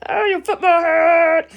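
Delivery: loud, drawn-out cartoon taunt; high, slow mock yell of an insult; Helga. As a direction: stretch out the insult loudly like a cartoon bully. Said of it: "Helga was in love with Arnold. She's like".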